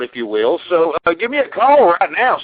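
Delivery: usual speaking voice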